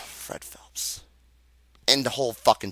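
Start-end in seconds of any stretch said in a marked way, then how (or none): 0.0-1.0 breathy whispered name after a sigh; exhausted whisper. Real, not acted. Vlogger whispering the pastor's name in weary disgust.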